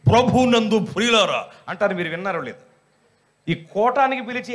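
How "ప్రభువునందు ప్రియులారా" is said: mock bass-voiced church announcer. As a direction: boom it out in a fake bass. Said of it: "base voice పెట్టి".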